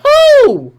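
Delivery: loud high "who?"; high loud scoff; incredulous. Real, not acted. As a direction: shoot it up in disbelief. Incredulous retort.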